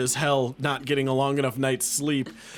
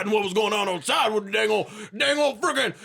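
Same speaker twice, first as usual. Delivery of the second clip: hillbilly yarn; 8 semitones higher than usual. Acted. Game master to listeners, rowdy folksy recap. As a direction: spin it like a rowdy country storyteller.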